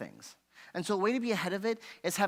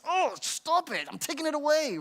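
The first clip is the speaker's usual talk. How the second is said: impulsive angry reaction; 10 semitones higher, 5 dB louder than usual. Acted. Parent losing patience with child, acted impulsive scolding. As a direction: let the gut reaction fly, half-acted. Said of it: "there's how we wanna react".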